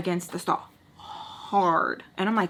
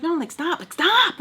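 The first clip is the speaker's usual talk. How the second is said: high, rising, louder insistence; exasperated outburst, pitched way up; retold angry protest; 9 semitones higher, 5 dB louder than usual. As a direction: act out losing patience, building to a cry. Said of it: "I was, like, just getting mad".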